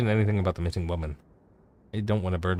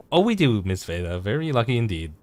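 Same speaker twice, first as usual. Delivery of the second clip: ordinary talk, nothing marked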